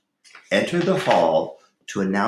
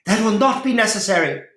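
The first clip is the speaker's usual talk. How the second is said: much higher, loud and fast outburst; angry shout; 14 semitones higher, 6 dB louder, faster than usual. A narrator's reading. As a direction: jump up and cut him off furiously. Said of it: "shouted Rowena".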